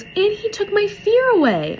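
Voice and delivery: put-on high little-girl voice; retold little girl. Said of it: "the little girl goes".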